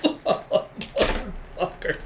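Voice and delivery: drawn-out, voiceless wheezing laugh; cracking up, word stretched and breaking; real helpless laughter. Real, not acted. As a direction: force the word out through laughter. Reader cracks up at twist.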